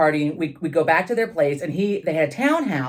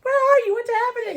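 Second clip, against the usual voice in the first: much higher, panicked father voice; alarmed dad. Retold or imitated. Father woken by a dawn call, panicked and high. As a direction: squeak out a startled dad's questions. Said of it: "he's like".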